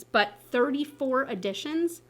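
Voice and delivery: ordinary talk, nothing marked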